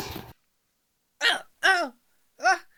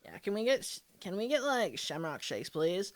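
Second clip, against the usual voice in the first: lower, softer bored order; low valley girl voice; 6 semitones lower, 7 dB quieter than usual. Acted.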